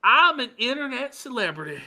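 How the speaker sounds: high smug mock voice; mocking Ralph. Host continuing Ralph's boast in a high mocking voice. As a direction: dismiss them with fake grandeur. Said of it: "this is Ralph being harassed".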